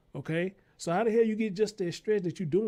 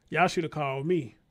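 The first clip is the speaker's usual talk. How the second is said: ordinary talk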